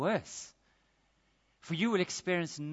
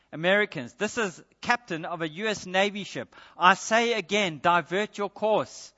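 loud, higher commanding naval captain; irate captain; 4 semitones higher, 6 dB louder than usual. Retold or imitated. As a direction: bark orders over the radio like an irritated captain. US captain angrily asserts rank.

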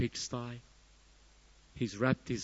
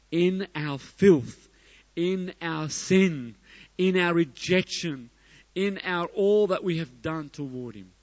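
impassioned preaching; 6 semitones higher, 6 dB louder than usual. Real, not acted. Preacher listing our wrongs against God, impassioned preaching.

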